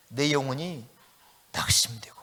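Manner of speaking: breathy whisper, almost gone; whispered despair. Acted. Preacher whispering the psalmist's words of despair. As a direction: let the word fade into a whisper.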